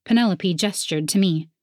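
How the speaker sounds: usual speaking voice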